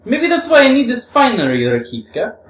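high, loud, spiteful taunt; gloating jibe, pitched high; malicious. Acted. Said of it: "finished with a malicious little laugh".

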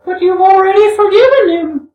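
very high, loud, drawn character voice; high-pitched, ringing Alyosha, said through a smile. Acted. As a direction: lift it far up into a smiling youth's voice. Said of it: "Alyosha said, smiling".

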